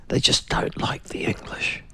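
hushed, whispered confidence; whispered aside. Real, not acted. Radio host confiding about the French, whispered aside. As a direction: whisper it like an open secret.

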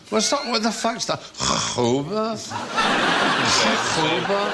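high, slow puzzled Scottish repeat; a light Billy Connolly puzzled riff. Retold or imitated. Imitating Billy Connolly's routine, lightly puzzled. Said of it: "famous routine about Chachoba".

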